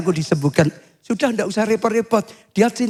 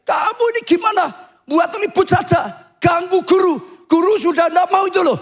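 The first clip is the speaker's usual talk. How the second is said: high-pitched acted telling-off; scolding; 10 semitones higher than usual. Crowd tells off the noisy blind man for bothering the teacher, scolding. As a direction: rebuke a nuisance, voice pitched way up. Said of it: "orang-orang banyak negur dia".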